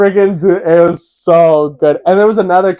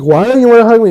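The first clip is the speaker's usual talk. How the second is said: higher, louder whiny plea; pleading whine; 7 semitones higher, 6 dB louder, much faster than usual. Retold or imitated. Uncle whining at his nephew for a hug, higher and louder. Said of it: "And I was like".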